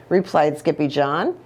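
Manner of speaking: ordinary delivery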